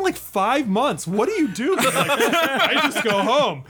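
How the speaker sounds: slow, high, laughing through it; stunned and cracking up; real laughter while quoting himself; laughing disbelief. Real, not acted. Laughing disbelief at his trick.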